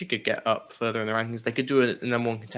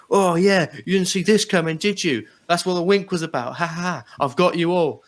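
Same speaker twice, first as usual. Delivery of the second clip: higher, louder smug gloat; imitating a gloating wrestler, higher and louder; gloating Eddie Kingston; 7 semitones higher, 5 dB louder than usual. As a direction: crow over the ambush, pleased with yourself. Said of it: "Eddie Kingston's like".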